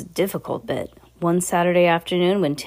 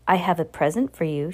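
ordinary delivery

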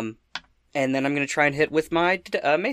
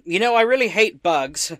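louder, higher declaration of dislike; emphatic battle remark; in-character grumble; 7 semitones higher, 6 dB louder than usual. Acted. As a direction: declare it forcefully while swinging a mace. I say as I try to smack it.

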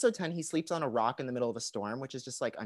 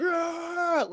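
high manic grunt; maniac grunt imitation; 14 semitones higher than usual. Podcaster imitates Savage's maniac grunt. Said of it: "like a freaking maniac".

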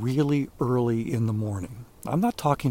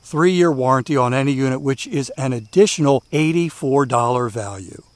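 upbeat, louder sponsor read; 2 semitones higher, 5 dB louder than usual. Acted. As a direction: sell it like a radio ad. Host reads sponsor ad.

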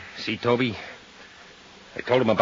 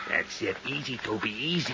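much softer, holding back a partner; quiet restraint; 9 dB quieter than usual. Acted. Calming partner during beating.

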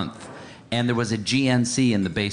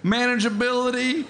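higher, hard-sell salesman voice; pushy salesman; 13 semitones higher than usual. Acted. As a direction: sell it like a huckster. Plays pushy supplement salesman.

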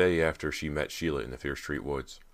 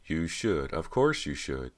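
usual speaking voice

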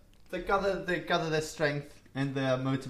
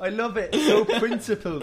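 laughing; 7 semitones higher, 5 dB louder than usual. Real, not acted. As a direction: gush through a laugh. Laughing admiration of principled coach.

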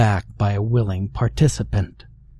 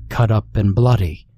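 plain reading voice

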